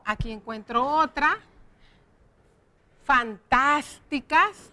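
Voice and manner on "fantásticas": "Fantásticas" slowly, with a long stressed "tás". Shown as a demonstration. Teacher pointing out an accent, "Fantásticas" stretched on "tás". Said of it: "también tiene acento".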